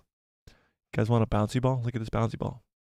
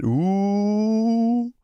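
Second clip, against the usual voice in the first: teasing ooh; 9 semitones higher than usual. Acted. Teasing chat about mystery girl.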